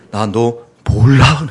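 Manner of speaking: slow, flat-out dismissal; slow cold voice of Jesus; cold rejection. Acted. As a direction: turn them away coldly.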